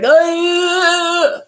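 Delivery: a high, loud garbled cry imitating a man who cannot speak. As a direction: sound out a strangled, wordless "Ah". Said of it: "It was more like".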